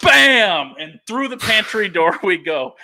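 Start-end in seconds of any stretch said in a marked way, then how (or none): 0.0-0.8 loud sharp bang imitation; impact noise. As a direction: shout the crash like a sound effect. Door crashing, imitated impact.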